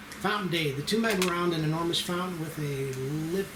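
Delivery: ordinary talk, nothing marked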